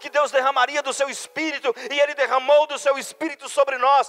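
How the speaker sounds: high, loud rapid-fire preaching cadence; pregação inflamada. Real, not acted. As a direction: preach at full force, driving phrase after phrase. Preaches at full force. (pregação fervorosa, voz alta e aguda)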